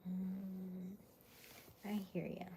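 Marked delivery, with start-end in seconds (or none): none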